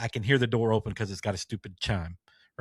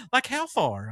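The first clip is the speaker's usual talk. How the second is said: imitating the lady; 13 semitones higher, slower than usual. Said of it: "she sounds just like".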